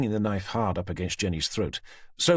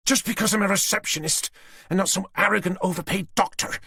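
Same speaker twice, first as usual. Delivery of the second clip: high, louder indignant snap; Susan snapping; 11 semitones higher, 3 dB louder than usual. A narrator's reading. Susan snapping at the detective. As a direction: bristle at the insult.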